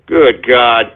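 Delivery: usual speaking voice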